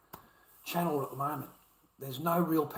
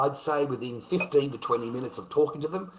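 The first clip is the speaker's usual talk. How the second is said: usual speaking voice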